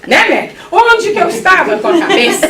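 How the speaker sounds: high, exclaimed self-reproach with laughter near; hammed-up exasperation, pitched high; mock despair. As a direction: exclaim it in comic regret. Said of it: "aí a gente começa a desesperar".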